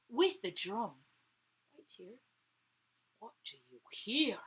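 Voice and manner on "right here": plain reading voice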